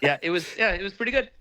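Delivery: laughing agreement. Real, not acted. Guest agrees the album was only pretty good, laughing. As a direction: agree while still laughing.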